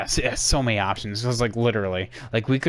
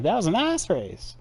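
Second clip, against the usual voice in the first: imitated Floridian accent, "nice" pitched up high; 8 semitones higher than usual. Streamer imitating Ross the Floridian, high-swooping Floridian accent. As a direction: mimic a Floridian drawing out "nice". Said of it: "he just has that natural accent".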